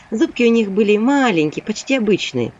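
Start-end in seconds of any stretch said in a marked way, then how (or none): none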